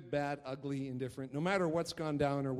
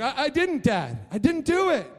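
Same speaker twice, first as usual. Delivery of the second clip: put-on high, loud child protesting innocence; pleading kid; 12 semitones higher, 10 dB louder than usual. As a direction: whine it like a kid denying it. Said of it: "He's like".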